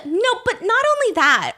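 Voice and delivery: high-pitched contradiction; nitpicking retort; mock contrarian. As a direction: imitate someone who must correct everything. Mimicking someone contradicting everything.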